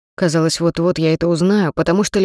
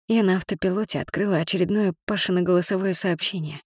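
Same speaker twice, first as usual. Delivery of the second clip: plain reading voice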